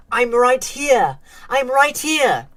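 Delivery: high, loud, drawn-out cries; desperate screaming. A narrator's reading. Unnoticed boy screams to his mom and sister, desperate. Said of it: "tried desperately to scream".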